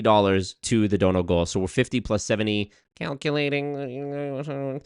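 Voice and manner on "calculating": very slow, hushed stalling; drawn-out calculating. Pretend. Streamer stalling while adding donations, drawn-out mumble. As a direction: stretch it out under the breath.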